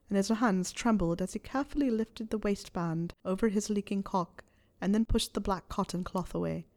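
ordinary delivery

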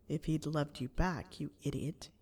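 low, quiet nagging inner voice. A narrator's reading. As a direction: needle softly from inside the head. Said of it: "That annoying voice demanded".

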